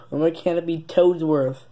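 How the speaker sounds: ordinary talk, nothing marked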